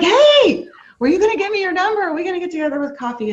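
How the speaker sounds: a keen new acquaintance catching up with you; excited woman calling out. Retold or imitated. Retells a woman calling out to her.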